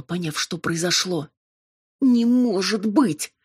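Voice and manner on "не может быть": high, loud, drawn-out outrage; furious exclamation. A narrator's reading. Amalia recognising the demon, furious outcry. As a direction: exclaim it slowly in furious disbelief. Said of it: "яростно воскликнула она".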